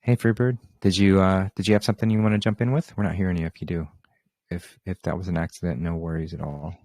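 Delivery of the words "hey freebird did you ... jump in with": louder, higher call to a silent caller. Real, not acted. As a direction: call across to someone who might not hear. Calling to silent caller on line.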